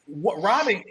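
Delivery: ordinary talk, nothing marked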